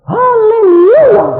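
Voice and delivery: high, long, loud hallelujah; imitated ecstatic praise shout. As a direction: shriek praise with a crying edge. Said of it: "muji Tuhan".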